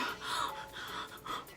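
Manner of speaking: gasping for breath unvoiced; panicked gasping. Shown as a demonstration. Girl showing how she gasped crying on phone, breathless gasps. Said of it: "literally like that".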